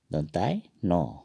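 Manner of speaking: "दंतान्य" spoken slowly, each syllable clear; slow careful pronunciation. Shown as a demonstration. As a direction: model "दंतान्य" slowly for learners. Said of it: "उच्चारण इसका इस तरह होता है". (धीरे-धीरे "दंतान्य")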